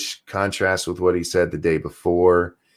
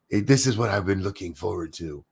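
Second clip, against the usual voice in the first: mock quote; 6 dB quieter than usual. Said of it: "The fuck do you mean?".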